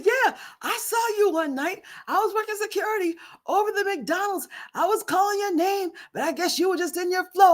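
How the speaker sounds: high retold explanation; imitating the ex. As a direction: lightly voice his story.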